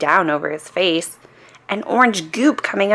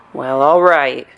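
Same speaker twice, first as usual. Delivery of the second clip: lower, lightly voiced policeman; 4 semitones lower than usual. A narrator's reading. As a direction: give in like a reluctant cop. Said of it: "said the policeman".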